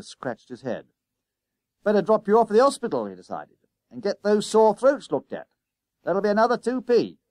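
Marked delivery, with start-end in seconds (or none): 1.8-3.1 high, loud and fast; bus conductor. Acted. Conductor hustles the boys. 3.9-7.2 loud, high-pitched conductor voice; brisk bus conductor. Acted.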